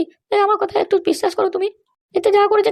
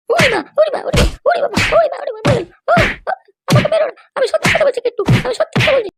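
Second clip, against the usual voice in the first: wailing for mercy; 4 semitones higher than usual. Acted. Wife beaten by husband, wailing and begging for mercy. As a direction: wail and plead while being hit.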